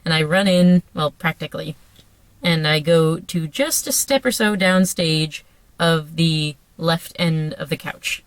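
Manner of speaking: ordinary delivery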